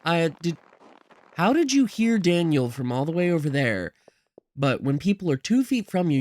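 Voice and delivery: normal talking voice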